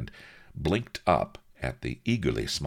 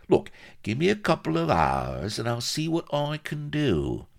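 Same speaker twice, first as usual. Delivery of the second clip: high-voiced wheeler-dealer; wheedling shyster; 8 semitones higher than usual. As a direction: talk him round in a high crook's voice. Shyster offers a deal.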